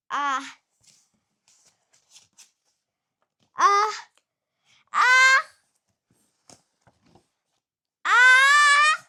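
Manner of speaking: building sneeze. Acted. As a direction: wind up a huge sneeze, climbing.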